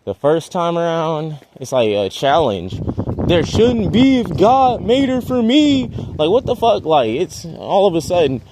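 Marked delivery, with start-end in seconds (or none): none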